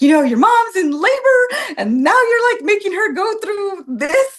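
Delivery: husband scolding their daughter for upsetting mom in labor, voiced high and loud; retold upset scolding. Voice climbing high as he scolds. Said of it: "And so then he was just like".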